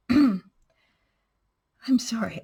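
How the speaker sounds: out of breath. Real, not acted. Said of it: "I'm out of breath".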